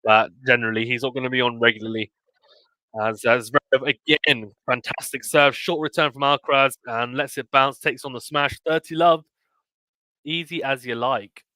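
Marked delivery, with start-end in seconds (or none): 3.0-11.4 lively, higher tennis commentary, calling each point; excited live sports call; play-by-play. Real, not acted. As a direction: call the rally as it happens.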